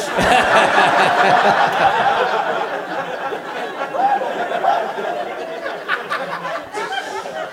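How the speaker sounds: long, high rising cackle; real hysterics; hysterical laugh. Real, not acted. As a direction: lose it completely.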